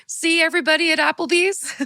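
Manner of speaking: high, proud call to a room; bragging mom. Acted. Mom showing off to the restaurant, lightly voiced proud brag.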